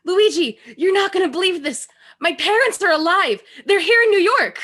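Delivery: excited news. Acted. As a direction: burst in with the big news. Hildy shares joyful news.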